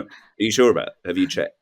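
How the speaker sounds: ordinary talk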